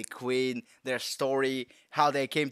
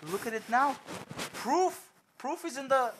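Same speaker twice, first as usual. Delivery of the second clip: excited; 10 semitones higher than usual. Real, not acted. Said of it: "Because I'm so excited".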